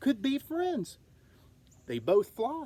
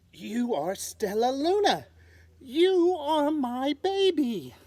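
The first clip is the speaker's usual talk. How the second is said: high, loud, slow, overcome with emotion; tearful recognition; 7 semitones higher, 6 dB louder than usual. Acted. Mother bat recognizing her lost baby, overcome. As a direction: let joy break through slowly. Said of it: "she whispered".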